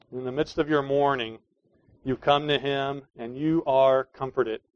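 ordinary talk

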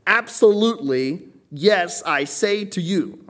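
high, loud, slow stress on Absolutely; very emphatic proclamation. Acted. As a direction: proclaim Absolutely and yes loudly, each drawn out high. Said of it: "this is very emphatic".

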